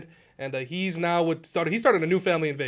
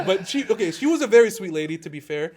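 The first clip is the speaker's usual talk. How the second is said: normal talking voice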